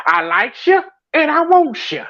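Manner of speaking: high-pitched character quote; playful high imitation, rising as it goes; booty warrior. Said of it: "you know the booty warrior".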